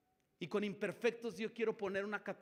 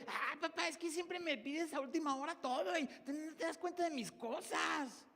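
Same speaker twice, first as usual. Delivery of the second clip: put-on high, whining teen complaint to dad; mock whiny child griping at father; whiny complaining tone; 8 semitones higher than usual. Shown as a demonstration. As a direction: whine it like a fed-up kid. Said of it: "Cambia tu tono de voz".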